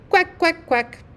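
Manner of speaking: high quacks dropping at the end; duck quacking. A narrator's reading. Duck swimming away downstream, high light quacks.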